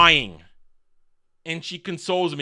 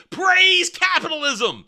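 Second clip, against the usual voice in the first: high, loud, drawn-out cheer; mock proclamation; 11 semitones higher, 5 dB louder, slower than usual. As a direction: crow it like a mock slogan. Mocking a king's greedy speech.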